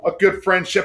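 ordinary delivery

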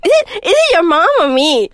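high loud whiny ultimatum; playing the clingy partner; demanding partner.

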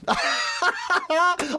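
a big delighted cackle. Real, not acted. Laughing at own joke.

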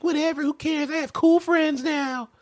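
very high, mock bratty voice; hammed-up high voice, dragging out the end; dismissive teen girl. Host mimics the girl brushing off Cassie, high and dismissive.

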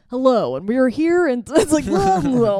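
reporter voice. Shown as a demonstration. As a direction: play a TV reporter opening the broadcast. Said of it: "Do you have a reporter voice?".

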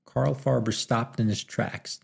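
plain reading voice

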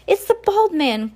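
high excited cry; high-pitched alarmed cry; cried out. A narrator's reading. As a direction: shout it out in alarm. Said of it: "Violet cried".

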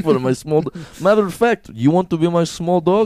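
put-on playful Russian-accented captor; mock Russian accent. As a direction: make the offer in a Russian accent. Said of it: "now you have Russian accent".

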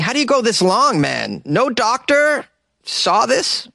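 high-pitched disbelief; incredulous. Real, not acted. Host baffled no doctor saw this, incredulous.

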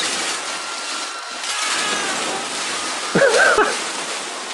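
breathy high silent laugh; long wheezing laugh. Real, not acted. Teller laughing before Bob's punchline, breathy wheeze.

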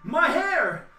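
very high, loud wail of despair; anguished shout. Acted. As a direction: scream over the ruined hair. Villain wails over his hair.

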